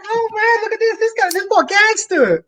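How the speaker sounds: hammed-up high voice of an amused cashier; retelling a cashier laughing at his knife; imitating the cashier lady. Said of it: "She's like".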